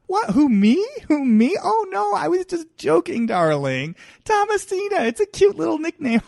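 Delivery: high, sing-song mock-innocent socialite; feigned innocence. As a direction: play dumb, all sweetness, darling. Speaker plays Kathy feigning innocence.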